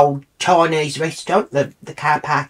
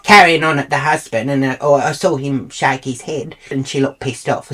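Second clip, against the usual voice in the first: ordinary talk, nothing marked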